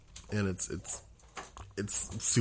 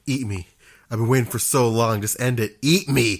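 louder, imitated frantic plea; freaking out; 2 semitones higher, 7 dB louder than usual. Retelling a cannibal victim from a Vine, mock freak-out. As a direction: mimic a comedian freaking out, building louder. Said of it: "he just starts freaking out".

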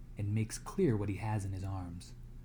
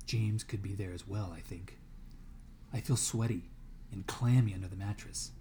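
ordinary delivery

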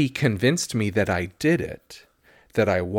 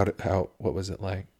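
whisper; 3 semitones lower, 6 dB quieter than usual. Acted. Spooky whispered ghost talk.

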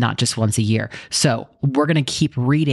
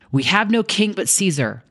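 higher defiant shout; shouting back; 7 semitones higher than usual. Acted. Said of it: "shouted back".

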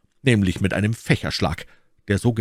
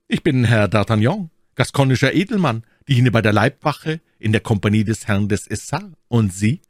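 raised, ringing self-introduction; proud young gallant; 4 semitones higher, 4 dB louder than usual. Acted. D'Artagnan introducing himself to the Englishman, proud. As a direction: announce yourself proudly, pitched up.

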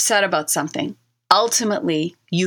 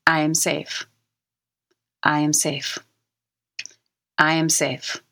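said like you mean it. Shown as a demonstration. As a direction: let each one sink in, calm and sincere. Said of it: "You say it like you mean it".

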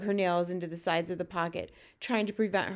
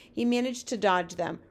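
ordinary delivery

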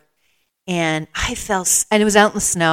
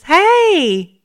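higher, louder friendly voice calling out in the dark; a kind runner finding a lost one, pitched up; concerned friendly stranger, higher and louder; 7 semitones higher, 6 dB louder, slower than usual. Retold or imitated. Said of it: "this friendly voice says".

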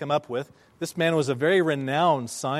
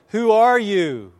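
loud, high, drawn-out question; calling out; 6 semitones higher, 9 dB louder, slower than usual. Retold or imitated. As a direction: shout it slowly across the valley. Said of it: "the boy called out again".

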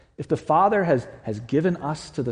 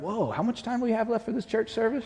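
high, mock-startled congregant; a high voice cut from the pew; impatient churchgoer; 9 semitones higher, 5 dB quieter than usual. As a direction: play a restless listener checking the clock. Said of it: "People are like".

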